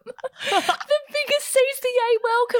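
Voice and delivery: bubbly host greeting total strangers; gushing welcome. Retold or imitated. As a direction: squeal a huge over-the-top welcome. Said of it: "I was like".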